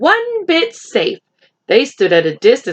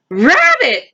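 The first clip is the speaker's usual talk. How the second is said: calling rabbit; 7 semitones higher, 4 dB louder than usual. Acted.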